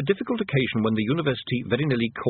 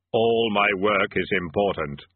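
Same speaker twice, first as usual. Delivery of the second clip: grim reaper voice; 2 semitones lower, slower than usual. A narrator's reading. Death speaks.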